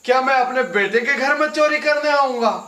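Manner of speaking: offended father raising his voice; indignant outrage. Acted. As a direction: demand it as a father insulted by the accusation. Father accused of theft by daughter-in-law.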